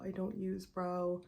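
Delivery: ordinary delivery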